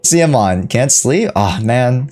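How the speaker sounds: normal talking voice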